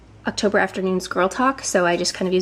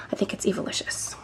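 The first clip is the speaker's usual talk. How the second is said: whispered aside; 8 dB quieter than usual. Real, not acted. Unsure aside about a brand name.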